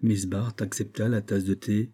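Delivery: usual speaking voice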